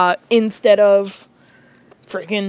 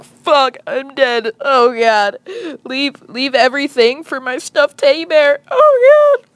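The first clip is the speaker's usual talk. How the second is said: raised, pleading last words; mock doomed panic; 5 semitones higher, 5 dB louder than usual. Boy seeing her dad with a bat, mock doomed panic. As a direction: build to a high wail of dread.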